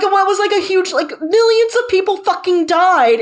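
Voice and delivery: rising outrage, voice pushed up; indignant outburst. Real, not acted. Podcaster ranting about the book, high indignant voice. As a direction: pitch climbing high in disbelief.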